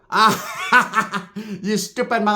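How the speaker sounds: high imitated cackle into words; mock mocking laugh. Tia hears they got jumped, high imitated laughing. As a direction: laugh at them like a gleeful aunt.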